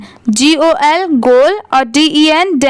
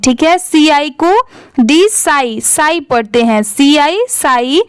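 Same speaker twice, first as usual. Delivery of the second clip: usual speaking voice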